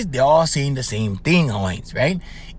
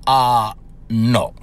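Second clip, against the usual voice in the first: lower, dry imitation of mother saying no; mother's flat refusal; 7 semitones lower than usual. Mother refusing her son's plan, lower and dry. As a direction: drop the pitch and shut it down. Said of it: "And my mother said".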